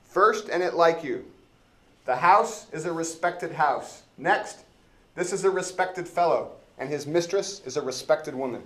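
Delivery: eager, self-important officer complaining to the judge; Elbow the constable. Acted. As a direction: play the pompous dim-witted constable, up in pitch and loud. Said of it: "I wanna be elbow".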